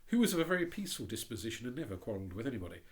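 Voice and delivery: usual speaking voice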